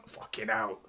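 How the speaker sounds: whispered frustrated curse; under the breath. Real, not acted. Fan watching the match, swearing in a whisper. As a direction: breathe it out in disgust. Groans at a missed chance.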